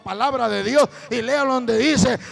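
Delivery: preaching at full force. Acted. Preacher proclaims Bible verses to the congregation, shouting.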